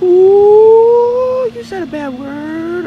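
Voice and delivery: long high drawn-out taunting "ooh"; tattling classroom chorus. Retold or imitated. Classmates react to pupil cursing at teacher, tattling chorus. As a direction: mimic a whole class of scandalized kids. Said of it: "everybody in class is like".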